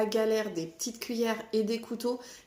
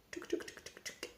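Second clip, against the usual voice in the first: mouth drumroll; 15 dB quieter than usual. Pretend. Drumroll before the price.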